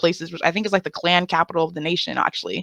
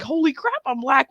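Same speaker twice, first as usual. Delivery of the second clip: higher mock cartoon dad exclaiming; Peter Griffin; 6 semitones higher than usual. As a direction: shout it like a startled cartoon dad. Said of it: "Peter Griffin Family Guy that he was like".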